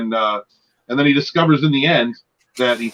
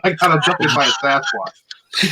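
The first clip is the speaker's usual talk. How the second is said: slow, higher joking Irish brogue; mock Irish accent; 3 semitones higher, slower than usual. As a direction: tell it in a silly Irish lilt. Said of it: "I'm doing an Irish accent".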